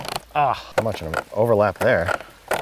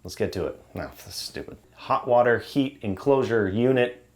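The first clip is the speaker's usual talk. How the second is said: normal talking voice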